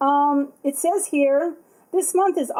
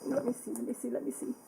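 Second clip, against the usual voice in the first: much softer, under-the-breath repeats; muttering to herself; 14 dB quieter, faster than usual. Real, not acted. Checking the box alone, quiet muttering. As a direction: drop to a private mutter while fiddling.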